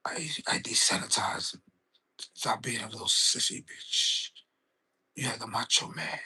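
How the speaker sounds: low, soft, near-whispered menace; low Jake the Snake voice. Retold or imitated. To Macho Man backstage, a soft low whisper. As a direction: hush it down like a calm, creepy snake handler. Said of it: "Jake would talk low".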